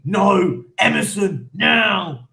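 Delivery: loud, emphatic parental no; hammed-up dad laying down the law; mock stern refusal. Dad refusing his kids' kit requests, mock shouted order.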